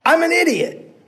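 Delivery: very high, loud; yelling. Acted. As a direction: keep yelling down. Hero still yelling.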